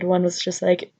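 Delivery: ordinary talk, nothing marked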